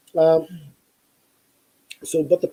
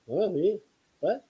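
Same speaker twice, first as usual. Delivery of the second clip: higher, softer startled "me?"; imitating a startled parent's double take; mock surprise; 8 semitones higher, 5 dB quieter than usual. Parents called out of the line, lightly surprised and higher.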